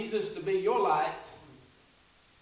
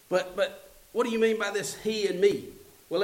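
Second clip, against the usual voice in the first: ordinary talk, nothing marked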